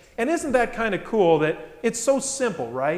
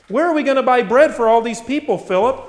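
loud, raised voice putting Philip on the spot; challenging question; 5 semitones higher, 7 dB louder than usual. Acted. Jesus testing Philip about the bread, challenging question. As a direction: throw the question out, high and loud.

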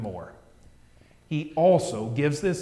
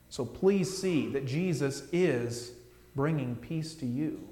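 normal talking voice

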